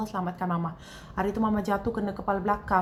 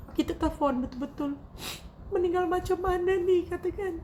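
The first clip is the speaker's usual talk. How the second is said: real tears, words strained as she recounts the death; tearful voice; 8 semitones higher than usual. Real, not acted. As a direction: speak through grief, holding back crying.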